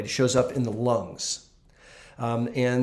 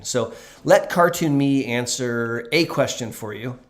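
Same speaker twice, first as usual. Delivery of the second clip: normal talking voice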